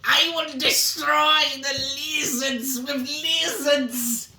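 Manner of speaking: high, loud cartoon villain voice; mustache twirling villain. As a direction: gloat like a silly supervillain. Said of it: "Sounds like a cartoon villain".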